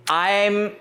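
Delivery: ordinary talk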